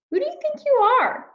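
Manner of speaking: high, fast, haughty outburst; indignant cry. Acted. Rainbow fish snaps at the little fish, high, fast and haughty. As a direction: scoff it out, proud and offended. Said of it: "cried the rainbow fish".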